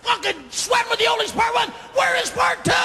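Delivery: high furious shout at Richard; mock outraged mob shouting; angry audience yelling. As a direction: scream it like a cheated fan. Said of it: "there was an audience going".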